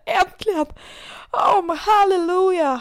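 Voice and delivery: high, drawn-out hallelujah of deliverance; overjoyed relief. Retold or imitated. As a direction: act out near-tearful relief at long last. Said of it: "då kan jag typ börja gråta".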